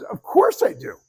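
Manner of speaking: high, loud, emphatic admission; emphatic retort, pitched well up; Tony Robbins. Retold or imitated. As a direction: imitate a big amused comeback. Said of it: "Tony cracks up".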